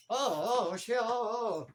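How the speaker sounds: mock dazed groan. Acting out guy falling from punch.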